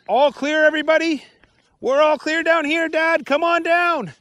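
high, loud call up to Dad. Acted. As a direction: shout up the slide so Dad hears. Henry calls up over walkie-talkie.

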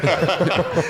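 a sudden high laugh; a bright, gleeful laugh; high burst of laughter. Real, not acted. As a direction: break into laughter. Guest laughs at the comeback he held back.

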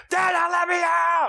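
sudden high imitated kid scream; screaming child. Son yelling through the timeout door, imitated high scream. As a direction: burst into a drawn-out, high mimicked tantrum yell. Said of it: "he screamed and screamed and screamed".

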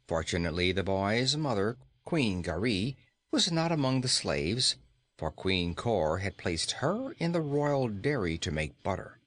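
ordinary delivery, nothing marked

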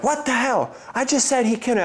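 high, exasperated scolding; hammed-up irritated wife voice; annoyed wife. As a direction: catch him red-handed. Wife catching dad.

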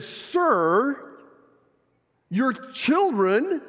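put-on "Sir" high and pointed, then a slow, drawn-out "your children"; a fed-up stranger finally saying it out loud, slowly; exasperated rebuke. Frustrated passenger finally speaks up.

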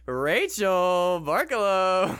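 slow, loud, grand name call; announcer voice. Acted. Announcing next player.

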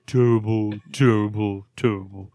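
hammed-up copy of a buddy's Trump-Barkley voice. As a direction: mimic a friend's whispered Barkley. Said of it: "He just whispered in a deeper voice".

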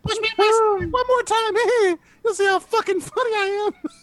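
very high squeaky dough creature; Pillsbury Doughboy. Acted. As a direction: squeak it like a cartoon blob. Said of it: "Attack of the Pillsbury Doughboy".

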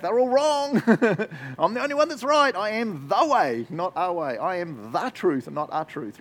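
high, loud, cocky voice of a religion that says it alone is right; mock arrogant religion. Preacher mocks a religion claiming exclusivity, in a smug high voice. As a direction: ham up the smug know-it-all. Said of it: "one of the religions is like".

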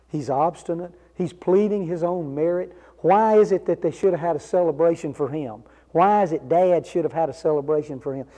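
ordinary talk

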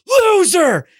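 high-pitched drive-by jeer; stretched, high imitated scream; mock scream. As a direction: yell it out like a heckler in a passing car.

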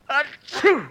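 big sneeze. Acted. Sneezing with a cold.

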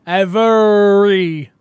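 "everybody" slow, high and loud with the start stressed; trying it right. Shown as a demonstration. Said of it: "It doesn't sound as good".

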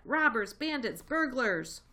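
loud, high shrieked insults; shrieking. A narrator's reading. Rat shrieks insults at the thieves, loud and high. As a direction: hurl each word after them. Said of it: "shrieked Rat".

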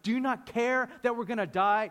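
high, loud panicked cry; panicked whining. Acted. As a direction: whine it like you're about to drown. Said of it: "They start crying and whining".

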